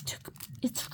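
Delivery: hushed whisper; whispered aside. Real, not acted. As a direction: whisper it like a confession. Complaining about hospital wait.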